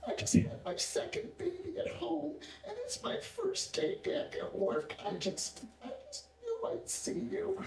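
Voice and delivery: high, soft tearful squeak; imitated weepy voice, small and high; mock crying. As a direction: act out a young nurse blubbering through her words. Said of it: "why are you crying?".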